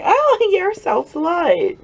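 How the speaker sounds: high, slow, drawn-out teasing squeal; mock outraged squeal. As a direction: playfully scold the daughter in a squeaky voice. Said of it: "I'm like".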